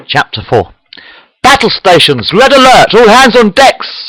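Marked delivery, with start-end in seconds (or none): 1.4-4.1 put-on high, loud captain's battle orders with a Russian accent; urgent Russian-accented command shout. A narrator's reading. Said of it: "shouts the captain in a Russian accent".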